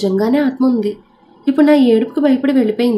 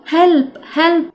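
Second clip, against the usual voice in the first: cry for help; 6 semitones higher than usual. A narrator's reading. Banging on glass calling help.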